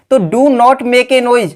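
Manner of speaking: each word drawn out for copying; slow dictation. Shown as a demonstration. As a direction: dictate it slowly to the class. Teacher dictates the English sentence. (धीमी गति से लिखवाना)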